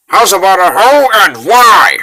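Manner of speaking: loud shout over the chaos; shouting question. Acted. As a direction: call it out hard and loud. Shouting over city under attack.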